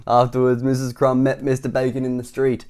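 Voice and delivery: usual speaking voice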